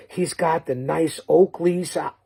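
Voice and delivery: ordinary talk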